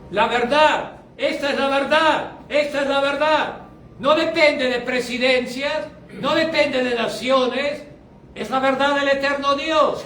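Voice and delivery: fiery sermon proclamation; forceful preaching. Acted.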